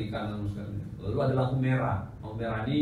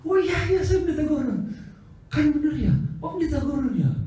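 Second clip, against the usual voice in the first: acted exchange; 4 dB louder than usual. Acted cafe dialogue, high voices.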